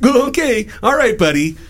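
"Oh" jumps high, mock-friendly; sugary brush-off, clearly fake; sarcastic. Shown as a demonstration. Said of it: "So I usually go straight to sarcastic".

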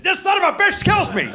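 sudden high mock yell with a laugh; laughing mock outrage at Shalhoub; mock shout. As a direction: blurt it out like a fake rant. Mock outburst about Shalhoub.

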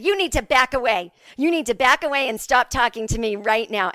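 loud, emphatic mock telling-off; mom yelling. Mom to a woman getting too close, scolding. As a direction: imitate a mother laying down the law. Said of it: "Of course I was yelling".

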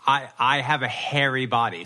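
normal talking voice

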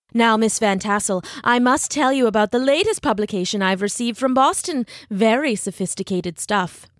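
self-important, lilting show-off; pompous schoolteacher. Acted. Ichabod shows off to Katrina, pompous and affected. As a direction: boast as if to impress a lady.

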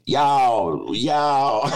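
drawn-out mock Southern "y'all"; hammed-up Southern twang; bad Southern accent. Shown as a demonstration. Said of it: "how I do a Southern accent".

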